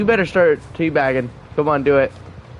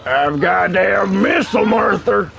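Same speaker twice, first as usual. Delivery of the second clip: shouting; 3 semitones higher than usual. Real, not acted. Calling out in game.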